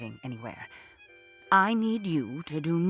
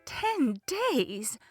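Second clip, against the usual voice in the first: aghast; 3 semitones higher than usual. Acted. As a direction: repeat it in theatrical disbelief. Ivanka aghast at the length.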